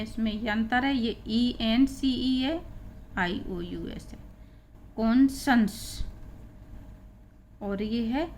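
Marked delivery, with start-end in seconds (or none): 5.0-6.0 slow, drawn-out "कॉन्शंस", kon-shuns; pronunciation demo. Shown as a demonstration. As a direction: stretch "कॉन्शंस" over two clear syllables, kon-shuns. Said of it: "इसको कॉन्शंस पढ़ेंगे".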